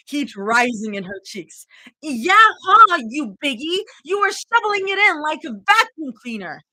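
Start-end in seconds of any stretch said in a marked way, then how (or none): 2.0-6.6 high, loud playful accusation; accusatory. Acted. Zoe accuses Rumi of shoveling the food, high and loud. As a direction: jab the blame right back. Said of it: "Zoe pointed an accusatory finger".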